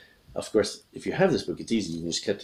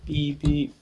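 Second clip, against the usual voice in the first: two high toots; imitated train beeps; 6 semitones higher than usual. Shown as a demonstration. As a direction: toot like a train horn. Train whistle for the train card.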